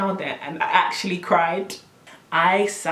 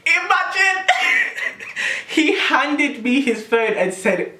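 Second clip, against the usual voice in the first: laughing while talking; 11 semitones higher, slower than usual. Real, not acted. Teller still laughing as she tells friends, laughing. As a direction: push the words out through giggles.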